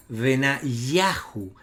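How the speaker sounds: Benayahu said very slowly with the stress on yah; correct stress repeated. Shown as a demonstration. Teacher repeating the stress on yah for students. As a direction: drag out Benayahu and press on yah. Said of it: "la sílaba acentuada justamente es yah".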